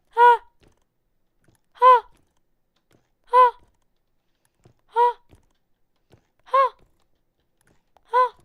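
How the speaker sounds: sharp high yells, one by one; loud high shouted "ha"s. Real, not acted. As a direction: bark out high taunts. Loud taunting calls at monster.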